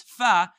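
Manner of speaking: loud "fa." over the lip; Arabic fa. Shown as a demonstration. Teacher shows fa after the five fingers joke. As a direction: blow out the fa. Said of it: "five fingers".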